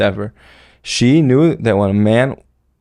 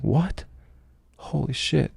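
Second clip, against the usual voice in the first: a higher, much softer gasp of shock; stunned disbelief; 5 semitones higher, 10 dB quieter, slower than usual. Retold or imitated. Hearing about the hostages, hushed in shock. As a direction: breathe it out hushed, thrown. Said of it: "And I'm like".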